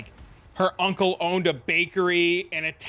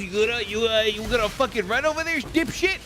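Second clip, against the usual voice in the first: imitating gym teacher; 5 semitones higher than usual. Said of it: "he comes up next to you and he goes".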